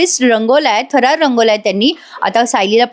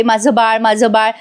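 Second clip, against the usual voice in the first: ordinary delivery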